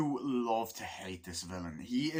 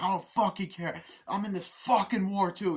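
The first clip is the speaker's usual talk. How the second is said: high, loud, heated retort; imitated defiant soldier; acted angry defiance; 7 semitones higher, 4 dB louder than usual. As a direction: throw it back in mock rage. Acting Barnes's angry answer.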